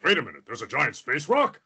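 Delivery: alarmed hero voice, sharp final question; John Stewart. Pretend. Superhero hearing of a space rock, startled and urgent. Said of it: "Bulby and John Stewart ever met".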